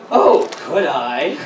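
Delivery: usual speaking voice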